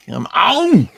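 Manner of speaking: loud exasperated yell; frustrated shout. Real, not acted. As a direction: bellow it at the slow computer. Frustrated at the slow computer.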